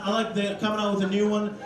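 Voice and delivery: usual speaking voice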